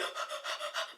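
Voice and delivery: a sudden, quick, fast breath in as if scared; snatch a frantic, breathless gasp; sharp panicked gasp. Shown as a demonstration. Teaching tattoo newbies how not to breathe, sharp panicked gasp. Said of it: "don't be like".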